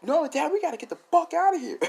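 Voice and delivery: very high, rushed squeal; urgent panic. Retold or imitated. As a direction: hurry it out, frantic to leave. Voicing urgent escape.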